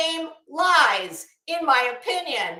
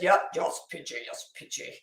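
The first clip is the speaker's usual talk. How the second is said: a muttered mock Norwegian accent, low and soft; imitating the Norwegian panel guest's accent, lower and softer; 11 semitones lower, 6 dB quieter than usual. Mocking the Norwegian lady agreeing with P G in a Norwegian accent. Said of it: "I know I'm butchering that accent".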